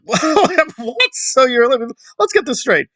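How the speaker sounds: high-pitched laughing disbelief; real amused bafflement, squeaky with laughter; incredulous laughter. Real, not acted. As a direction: laugh through it, voice jumping way up. Laughs at absurd claimed ancestry.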